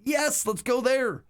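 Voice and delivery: high, slow, drawn-out enthusiasm; eager agreement. Retold or imitated. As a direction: replay an enthusiastic yes, stretched out and high. Retells eagerly accepting the dinner suggestion.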